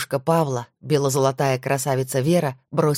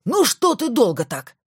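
raised, impatient shout; 8 semitones higher than usual. Acted. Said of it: "сразу закричал Макаров".

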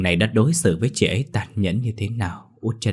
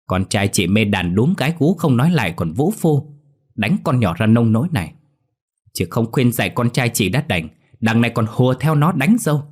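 louder, rising to a furious peak; indignant scolding; 3 dB louder than usual. Acted. Angry father confronting the mother-in-law, indignant rising scolding. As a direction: let the father's outrage build until it boils over.

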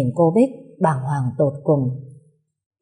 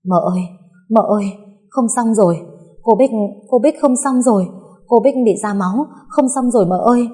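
raised, urgent cry for help; higher, frightened, words tumbling out in bursts; panicked and trembling; 5 semitones higher, 4 dB louder than usual. A narrator's reading. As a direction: blurt bad news in rising alarm. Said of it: "giọng bàng hoàng run rẩy".

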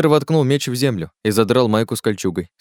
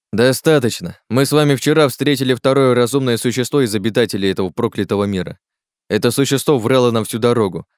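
forceful, raised leader's harangue; exasperated rebuke; 3 semitones higher, 3 dB louder than usual. Acted. Oleg scolds companions fighting in enemy territory, forceful and urgent. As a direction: drive the warning home to the group. Leader berates the quarrelling group.

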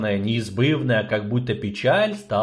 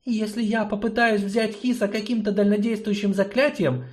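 high, confiding sorceress; secretive sorceress; 12 semitones higher than usual. Acted. Sorceress confides in gnome, high voice. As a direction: tell a secret.

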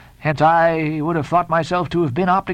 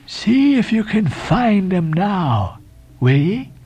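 high, slow, cryptic challenge; Elijah's voice; 4 semitones higher, 3 dB louder, much slower than usual. A narrator's reading. Elijah touching the shoulder again, slow high challenge. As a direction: drawl it as a strange prophet.

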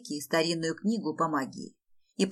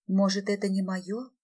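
plain reading voice

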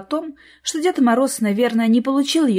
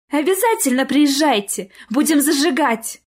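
high, lively Snow Maiden; perky, animated invitation; eager agreement; 6 semitones higher, 4 dB louder than usual. A narrator's reading. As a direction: chime in with gushing enthusiasm. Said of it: "поддакнула Снегурочка".

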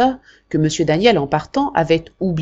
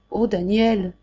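tearful murmur; 3 semitones higher, slower than usual. Acted. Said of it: "murmura d'une voix douce, pleine de larmes".

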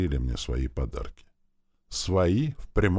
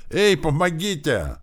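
high, loud, drawn-out call for help; louder yelling; 12 semitones higher, 5 dB louder, slower than usual. A narrator's reading. Boy being dragged down an empty corridor, louder yelling. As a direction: holler slowly for rescue. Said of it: "заорал погромче".

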